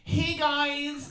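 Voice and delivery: high, put-on influencer hello; mock influencer greeting. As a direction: imitate a vlogger's drawn-out squeal. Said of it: "those that starts like this".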